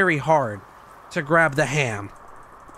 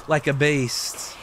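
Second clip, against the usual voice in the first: usual speaking voice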